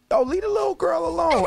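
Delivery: high-pitched protest. Retold or imitated. Host quoting everyone defending the gymnast, high-pitched protest. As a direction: protest on their behalf, high and insistent. Voicing the fans' protest online.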